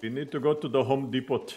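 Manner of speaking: put-on foreign accent, Home Depot as "homm dee-pot"; foreign parent's accent. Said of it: "funniest pronunciations of certain things".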